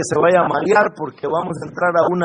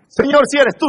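high, raised begging voice; urgent mock plea; 8 semitones higher, 5 dB louder, faster than usual. Preacher acting the wrong prayer for escape, urgent plea. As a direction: plead it high and pressing. The prayer Peter did not say.

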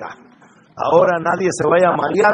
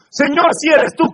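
high, raised begging voice; urgent mock plea; 9 semitones higher, 4 dB louder than usual. Preacher acting the prayer Peter did not say, urgent plea. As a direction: plead it high and pressing.

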